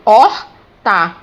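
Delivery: horta stretched and loud, opening on O with silent H; demonstrated pronunciation. Shown as a demonstration. Teacher showing the silent H, horta broken up slowly and loudly. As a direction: say horta slowly in two parts, OR-ta. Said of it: "quando eu vou pronunciar".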